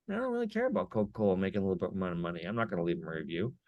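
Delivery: low, fast, flat brush-off under the breath; dismissive customer. Retold or imitated. Customer rejecting a corporate review request, fast low dismissive mutter.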